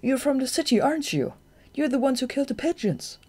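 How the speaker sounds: put-on high, quick young voice pressing questions; young bat Shade, eager. A narrator's reading.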